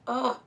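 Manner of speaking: loud, short disgusted groan; groan of dread. Real, not acted. Mother recalling a second needle, loud groan of dread. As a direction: groan at the very thought. Reacts to thought of repeat needle.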